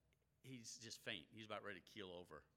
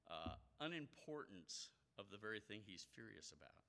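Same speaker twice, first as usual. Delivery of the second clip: ordinary delivery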